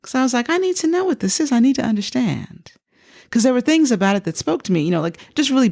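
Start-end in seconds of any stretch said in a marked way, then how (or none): 0.5-2.7 high-pitched, insistent retold plea; pitched way up, falling as it lands; urgent curiosity. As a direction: voice your eager need to find out. Retold urgent need to know.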